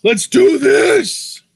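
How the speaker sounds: loud, slow rallying cry; hyped shout. Pretend. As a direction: bellow it like a crowd chant. Hyped shout at match start.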